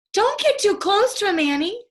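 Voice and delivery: loud, high warning shout; shouted warning to his sister. A narrator's reading. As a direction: call out to stop her. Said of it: "Jack shouted".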